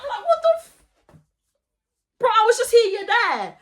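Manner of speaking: re-enacted high-pitched bewilderment; high, incredulous retold reaction; shocked. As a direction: act out being startled, pitch jumping up. Retells own shock at her jump.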